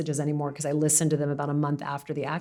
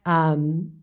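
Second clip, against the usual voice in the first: ordinary talk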